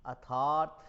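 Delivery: अर्थात with the r dropped, "athaat"; misspelt pronunciation. Shown as a demonstration. Teacher reads out the misspelt example to students, mispronounced on purpose. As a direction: say अर्थात loudly as "a-thaat", no r. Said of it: "कई बार अशुद्ध लिखा जाता है".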